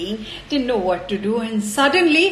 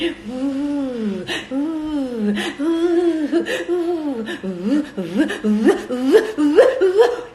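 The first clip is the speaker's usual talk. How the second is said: swooping howling wind sound; playful wind ooh; 2 semitones higher, much slower than usual. Acted. Storyteller voices the wind.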